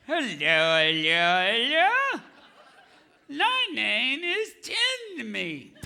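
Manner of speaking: slow ventriloquist puppet voice; a hint of British puppet. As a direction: try a puppet voice without moving lips. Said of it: "British puppet named Timmy".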